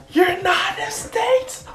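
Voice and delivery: high, slow, drawn-out battle yell; mock anime attack shout; attack cry. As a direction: stretch it out like an anime finishing move. Hero's finishing attack cry.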